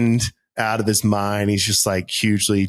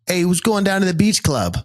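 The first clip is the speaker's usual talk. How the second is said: put-on tram driver in a thick Boston accent, a bit higher; broad Boston vowels, cheerful call-out; 7 semitones higher than usual. Tram driver calling to riders, in a Boston accent. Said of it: "switch accents quick".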